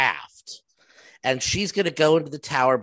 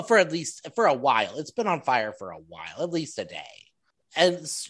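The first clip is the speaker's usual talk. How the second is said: normal talking voice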